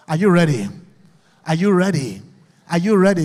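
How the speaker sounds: shouted question to the crowd; loud call. Real, not acted. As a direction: call out to rouse the hall. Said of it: "You don't sound like you are ready".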